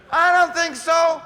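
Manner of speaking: loud, slow, high refusal; emphatic shout. Acted. Preacher to the ladies, shouting a slow loud refusal. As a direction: belt it out: I don't think so. Rejecting debt-laden suitor, shouting.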